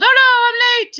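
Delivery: high-pitched mock dismay; exaggerated high oh-no voice; acted-out chat message. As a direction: read a friend's message in a squealed-up, playful panic. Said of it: "Rick says".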